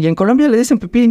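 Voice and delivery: high, quick incredulous question. Retold or imitated. The Mexican comics asking the Colombian girl, high and incredulous. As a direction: fire it off in disbelief.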